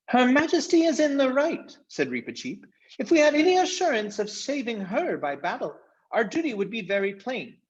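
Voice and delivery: high, ringing, noble declaration; gallant mouse knight. A narrator's reading. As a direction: proclaim her act heroic like a proud little knight. Reepicheep speaks nobly.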